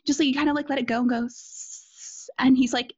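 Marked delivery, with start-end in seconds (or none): 1.2-2.3 long soft unvoiced sss, like air leaking; slow hiss. Shown as a demonstration. Imitating the boyfriend's advice on farting silently as a slow hiss. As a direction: let a quiet hiss seep out. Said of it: "Let, like, like a slow hiss".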